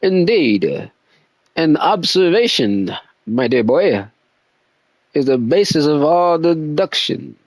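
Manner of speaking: lightly put-on English gentleman accent. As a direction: read it as a mild posh Englishman. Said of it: "like an Englishman accent".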